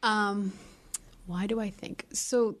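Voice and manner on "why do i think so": normal talking voice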